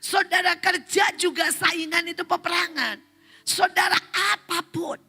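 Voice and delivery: high, projected sermon cry; rally the congregation with a ringing exhortation; impassioned preacher, pitched far above talk; full-force preaching. Real, not acted.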